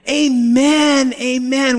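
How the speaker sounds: high, emphatic amens; eager congregational response. Retold or imitated. The people answering Ezra's blessing, high eager amens. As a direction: answer eagerly, pitched well up.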